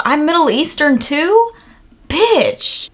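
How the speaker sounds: high-pitched, incredulous outburst; amazed. Real, not acted. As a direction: blurt it out in delighted disbelief. Amazed at an unexpected heritage.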